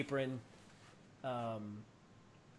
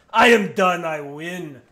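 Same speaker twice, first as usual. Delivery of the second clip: loud, booming win shout; big drinker boast; 3 semitones higher, 16 dB louder, slower than usual. Acted. Giant slamming his mug after winning, booming boast. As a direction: bellow it like a giant who just won. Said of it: "And he goes".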